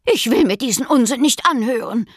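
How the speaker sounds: mild hammed-up scolding hare; shrill nagging. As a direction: nag and carp at the nonsense. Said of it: "zeterte die Häsin".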